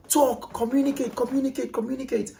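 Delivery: urging someone to speak up, then easing off; retold shouting. Frustrated parent urging a child to talk, high and pleading. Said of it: "I'm shouting and I'm like".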